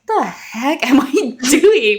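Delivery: high, loud, drawn-out self-mockery with a laugh; laughing disbelief. Mother retells catching herself self-talking, high laughing disbelief. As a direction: laugh at yourself in mock bewilderment.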